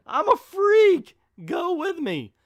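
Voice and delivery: very high, loud, drawn-out goofy voice; cartoonish squeak of "I'm a freak"; mock dopey Jay. Reviewer parodying Jay asking her to the dance, silly squeal. Mocking Jay's line.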